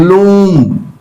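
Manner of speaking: loom with the "oo" held long; long vowel. Shown as a demonstration. Teacher reading the word list, long vowel in loom. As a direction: stretch out loom on a falling end. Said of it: "long vowel sound".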